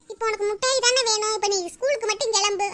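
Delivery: ordinary delivery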